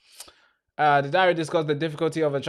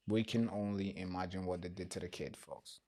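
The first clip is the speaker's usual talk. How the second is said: much softer, trailing off; fading grimly; 5 semitones lower, 15 dB quieter than usual. Real, not acted. Streamer trailing off grimly about the child. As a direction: let it sink to almost nothing. Repeats the line, fading away.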